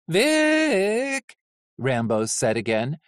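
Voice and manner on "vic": high, pleading call of the name; urgent, anxious alarm; rising nervous call. Acted. Small robot Rambo warning Vic, high and anxious. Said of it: "voice rising".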